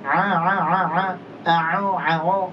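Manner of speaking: a a a o sounds pushed through the nose; nasal ain. Shown as a demonstration. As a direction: demonstrate the wrong nasal ain. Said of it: "Keluar dari hidung".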